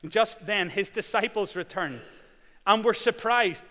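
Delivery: loud, high full-force preaching. Acted. Preacher retelling Jesus to the congregation, loud and high. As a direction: proclaim it to the whole church.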